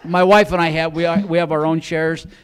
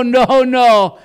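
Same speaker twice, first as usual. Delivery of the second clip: loud, high protest; emphatic refusal; 8 semitones higher, 9 dB louder than usual. Retold or imitated. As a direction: refuse in mock alarm. Husband refuses the costly dog.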